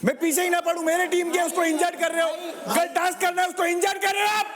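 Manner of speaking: high, heated voice; angry protest. Real, not acted. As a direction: argue hotly for your injured teammate. Angrily defending an injured teammate.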